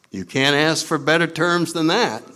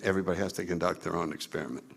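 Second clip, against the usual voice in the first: fast, low, much softer answer; quick low aside; 8 semitones lower, 11 dB quieter, much faster than usual. Real, not acted. Speaker answering his own question, quick quiet aside. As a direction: toss the answer off quickly under the voice.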